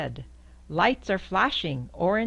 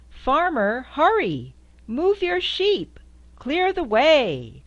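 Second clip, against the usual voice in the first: calling out; 8 semitones higher, 6 dB louder than usual. Acted. Driver calling to the farmer to move his sheep. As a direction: shout ahead to clear the road.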